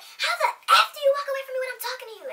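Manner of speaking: loud, high, fast mock yell; high-pitched outraged roommate impression; imitated yell. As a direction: yell it in a squeaky mocking rush. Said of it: "she yells".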